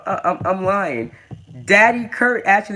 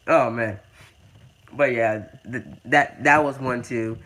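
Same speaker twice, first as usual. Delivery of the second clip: usual speaking voice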